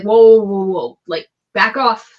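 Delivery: loud, startled whoa; startled shout. Acted. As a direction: push the crowd back with a yell. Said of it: "And he's like".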